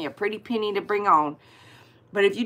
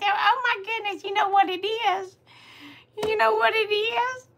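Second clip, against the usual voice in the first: put-on grandma voice; 12 semitones higher than usual. Retelling shower talk in grandma voice.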